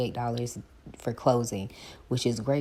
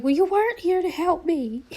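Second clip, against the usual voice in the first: much higher, louder, whining; high whiny shout; 8 dB louder than usual. Retold or imitated. Blaming best friend, high loud whine. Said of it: "and I was like".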